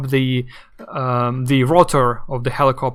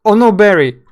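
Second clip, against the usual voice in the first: louder, overplayed sorrowful exclamation; mock melodramatic reply; 4 semitones higher, 8 dB louder than usual. Alan answering dying Barry on the phone, hammed-up mock grief. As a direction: send up a cheesy game reaction. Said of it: "over the phone".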